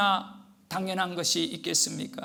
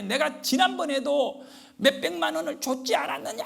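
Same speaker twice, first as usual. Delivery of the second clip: mild mock yell, pitched up; imitated angry yelling; 7 semitones higher than usual. Preacher imitating woman complaining about money, mild mock yelling. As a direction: retell an angry phone complaint, half-heartedly yelled. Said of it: "소리를 지르면서".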